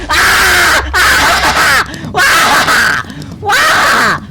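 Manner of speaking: high, loud victory yells; In your face, shrieked in triumph; triumphant screaming. Real, not acted. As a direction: gloat and scream as you win. Winning the round, gloating.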